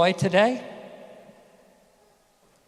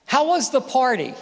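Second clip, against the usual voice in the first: higher, louder eager question; eager parent; 7 semitones higher, 3 dB louder than usual. Retold or imitated. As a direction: ask with hopeful eagerness. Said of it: "you'd go".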